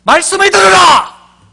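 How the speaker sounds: huge high booming proclamation, slow; prophet's thundering call to hear the word; shout. Shown as a demonstration. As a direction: roar it like a prophet. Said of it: "소리를 질러보도록 하겠습니다".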